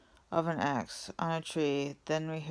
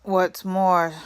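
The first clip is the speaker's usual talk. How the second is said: loud angry snap; snapped; 3 semitones higher, 9 dB louder than usual. A narrator's reading. Lorax snapping at the Once-ler, loud. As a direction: bite the words off hard. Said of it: "snapped the Lorax".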